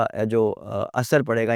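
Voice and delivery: ordinary delivery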